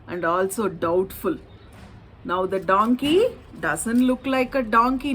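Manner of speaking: ordinary delivery, nothing marked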